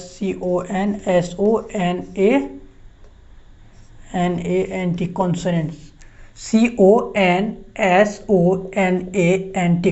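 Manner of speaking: letters spelt out one by one, slowly; slow spelling. Shown as a demonstration. Teacher spelling consonant for students, slow and deliberate. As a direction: spell each letter so they can write. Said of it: "spelling out letter by letter".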